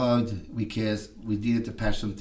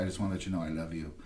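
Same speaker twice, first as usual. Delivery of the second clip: softer, fast tender confession; tender quiet; 6 semitones lower, 10 dB quieter, faster than usual. Retold or imitated. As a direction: say it softly, like you're laying your heart out. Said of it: "I said".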